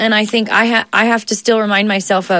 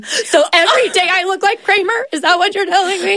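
higher, incredulous question to her husband; mock indignant; 10 semitones higher than usual. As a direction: play it up as outraged disbelief. Retorting to husband about Kramer hair.

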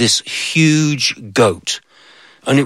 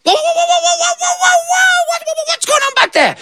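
very high yelping whoa; jolted by a bite; startled goat. Acted. Goat bitten by the ant, high startled yelp.

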